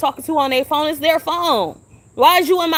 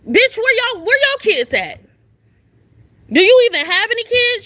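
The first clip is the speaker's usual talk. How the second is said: high, loud challenge flung back at her; furious rhetorical demand; angry retort; 9 semitones higher, 4 dB louder than usual. Real, not acted. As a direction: snap the question at her. Confronting the woman at shelter.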